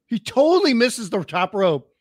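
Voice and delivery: usual speaking voice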